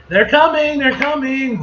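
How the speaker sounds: high, eager heralding; excited announcement. Acted. Announcing the keynote speakers, excited and high. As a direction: raise the cry of an arrival.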